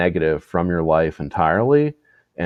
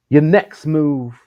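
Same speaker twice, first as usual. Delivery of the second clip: high, slow, stretched-out impression; 9 semitones higher, 3 dB louder, slower than usual. Repeating a celebrity's posted advice, slow high impression. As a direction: draw it out high like a grand motto. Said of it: "he does that stupid fucking voice".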